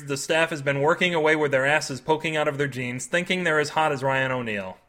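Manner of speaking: ordinary delivery, nothing marked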